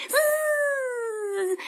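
long, high, soft zzz; bee buzz. A narrator's reading. Offended bee answers the puppy, long high buzz. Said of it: "прожужжала обиженная пчела".

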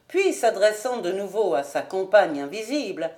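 plain reading voice

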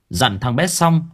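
ordinary delivery, nothing marked

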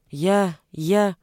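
«Я, я» with a stuck, halting start; stammering. A narrator's reading. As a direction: trip over «я» twice, caught out. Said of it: "запинался Вячка". (запинаясь на «я»)

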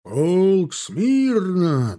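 very slow, high-pitched drill call; long-drawn call to attention; parade command. A narrator's reading. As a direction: stretch the order out across the field. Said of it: "донеслась команда барона Штекельберга".

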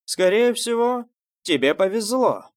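повезло pushed high and loud; stress on повезло. A narrator's reading. Envious rival talking down Июнь's talent, stressing повезло. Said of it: "сделал акцент на слове".

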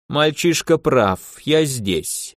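ordinary delivery, nothing marked

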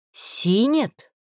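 husky, puzzled echo of a name; hoarse voice. A narrator's reading. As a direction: repeat it in a husky voice. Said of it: "сиплым голосом повторила я".

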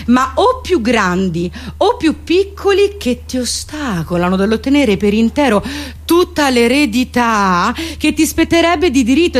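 normal talking voice

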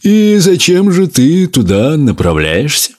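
high, slow haughty lizard voice. A narrator's reading. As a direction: sneer down at the tortoise. Lizard questions the tortoise.

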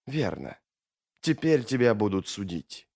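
low, soft, controlled menace; composed foe, voice held down; quiet verdict. Acted. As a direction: pronounce judgement without raising your voice. Mamun calmly pronounces judgement.

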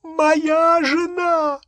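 very high, drawn-out scream; frantic wail. A narrator's reading. Real husband cries out for his wife, frantic wail. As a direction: wail it at the top of your voice. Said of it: "настоящий Редж Кроткот завопил".

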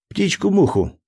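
put-on high, whiny child asking; a small boy pleading for a promise; little boy voice. A narrator's reading. Jack answering eagerly.

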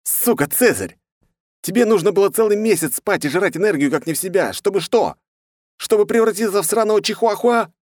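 high, loud exasperated yell. A narrator's reading. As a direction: shout it in outraged disbelief. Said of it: "и я заорал".

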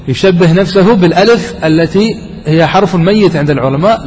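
ordinary delivery

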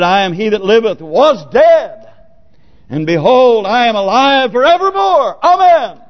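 ringing proclamation, climbing high to the amen; declaimed scripture. Acted. Preacher quoting the risen Christ, triumphant declaiming. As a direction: proclaim it with mounting force.

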